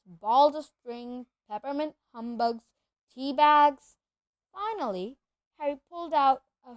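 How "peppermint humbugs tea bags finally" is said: plain reading voice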